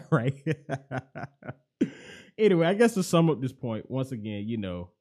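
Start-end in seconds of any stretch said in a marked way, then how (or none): 0.0-0.6 real chuckle breaking around the word; louder, laughing check-in with the listener. Real, not acted. Podcaster amused at his never-dull journey, laughing.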